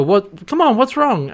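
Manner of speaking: high-pitched insistent questioning; acted exasperated pleading. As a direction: press them to explain, voice jumping up. John pestering Eren for an answer.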